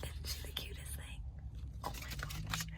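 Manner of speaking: whispered softly over the photo; tender whisper. Real, not acted. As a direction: breathe it out in awe, no voice.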